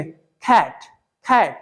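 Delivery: खैट with a strongly aspirated kh, pitched low; foreign-style cat. Shown as a demonstration. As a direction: breathe out hard on the k in खैट. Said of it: "अब कैसे बोलेंगे?".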